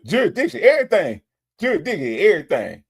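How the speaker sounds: half-laughing giddy repeat of his line; imitating excited Nesto. Host re-imitating Nesto's jurisdiction chant while laughing, giddy mock tone. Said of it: "He was so ex-, excited".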